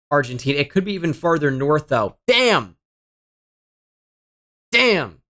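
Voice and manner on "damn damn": high, falling cry of frustration; frustrated outburst. Real, not acted. Player frustrated by the round, real outburst. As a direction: curse the result, pitch jumping up then dropping.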